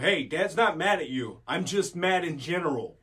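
loud mock-aggressive dad voice; dad addressing kids. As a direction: imitate yourself being forceful with the kids. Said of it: "That's how I am with the kids".

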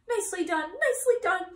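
a goose shrieking orders at the pig; frantic goose. A narrator's reading. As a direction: goose yelling at Wilbur to dodge the chasers. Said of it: "cried the goose".

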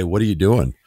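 usual speaking voice

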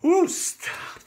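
high, slow excited whoop; getting excited. Real, not acted. Dreaming of boondocking by a lake, excited whoop. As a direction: let the excitement burst out, then rein it in. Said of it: "I'm getting excited".

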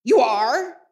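loud, incredulous echo; stern repeat. A narrator's reading. Scrooge repeats Bob's excuse back at him, loud and incredulous. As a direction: throw his words back at him. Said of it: "repeated Scrooge".

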